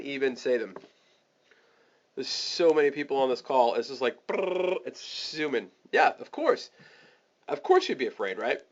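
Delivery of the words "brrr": higher rolled "brrr" whir; imitated whirring noise. Shown as a demonstration. Host describing names flying past, trilled whir. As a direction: trill a quick zooming noise.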